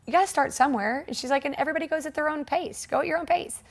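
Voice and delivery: high, lightly voiced upbeat instructor. Spin instructor inviting her to class, high upbeat coaxing. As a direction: coax like a cheery spin teacher.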